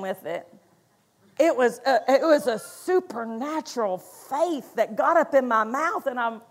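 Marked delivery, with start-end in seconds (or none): none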